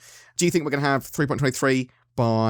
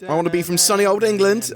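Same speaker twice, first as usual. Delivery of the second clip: higher, louder mock English accent; hammed-up English accent, raised and pushed; put-on English accent; 8 semitones higher, 3 dB louder than usual. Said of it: "I don't know why I'm doing an English accent".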